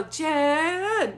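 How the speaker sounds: drawn-out cheerleader call; cheerleader cheer. Retold or imitated. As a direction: cheer the name out long. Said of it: "full on like a cheerleader".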